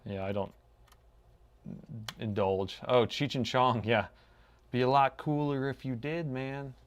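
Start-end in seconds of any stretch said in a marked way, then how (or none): 4.7-6.7 laid-back stoner, a bit higher; teasing in a chilled stoner voice. Pretend. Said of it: "talking in a weed, a stoner voice".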